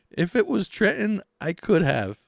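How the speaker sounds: high, loud mimicking voice; put-on high impression, easing down at the end; Hugh Giordano. Vlogger doing a Hugh voice, high mocking impression. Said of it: "this is my Hugh Giordano voice".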